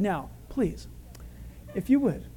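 soft, gentle courtesy; polite request. Acted. God speaking to a lady on the roof, politely. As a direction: ask delicately, with careful pauses. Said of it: "if this was a lady on the roof".

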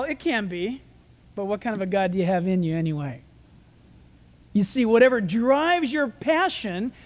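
ordinary talk

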